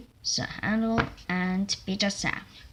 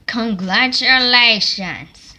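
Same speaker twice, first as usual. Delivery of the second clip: loud congratulation; 3 semitones higher, 13 dB louder, slower than usual. Acted. Driver congratulating.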